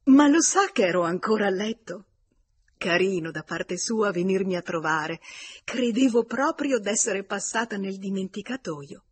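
animated hostess greeting a visitor, projected well above narration; loud, delighted-sounding social exclamation; lively exclamation. A narrator's reading. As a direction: exclaim it loudly with a lifted pitch. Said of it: "ed esclamò".

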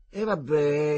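coaxing, pressing the deal; insisting. A narrator's reading. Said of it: "insistette Marcello".

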